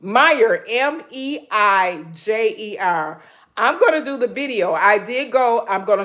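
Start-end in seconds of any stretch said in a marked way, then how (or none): none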